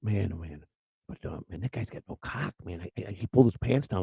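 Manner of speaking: hushed, mocking take on a drunk woman complaining; a half-whispered parody of women gossiping. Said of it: "and they're like".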